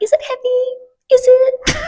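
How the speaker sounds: high, slow, wide-eyed innocence; hammed-up innocent disbelief; feigned innocence. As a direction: act like you had no idea. Lying to the attendant's face.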